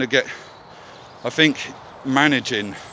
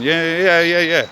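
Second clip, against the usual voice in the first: high, loud brush-off yeahs; dismissive yeah yeah, louder and higher; 7 semitones higher, 5 dB louder than usual. Real, not acted. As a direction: wave the critics off. Dismissing the sober critics.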